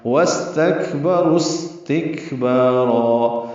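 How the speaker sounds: وَاسْتَكْبَرُوا اسْتِكْبَارًا drawn out, with both سین clear; slow tajweed recitation. Shown as a demonstration. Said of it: "دونوں سین ساکن،". (وَاسْتَكْبَرُوا اسْتِكْبَارًا آہستہ تجوید سے)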